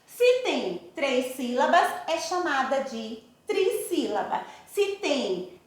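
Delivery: normal talking voice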